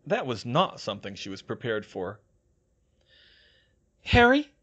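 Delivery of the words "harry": high, loud rising plea; helpless questioning cry; helpless Hermione. A narrator's reading. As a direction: call for help, pitched well up. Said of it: "she said helplessly".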